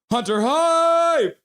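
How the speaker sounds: high, loud, slow holler with a long held "hype"; hype cheer. Real, not acted. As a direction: yell it like a rallying fan. Cheering on the hockey team.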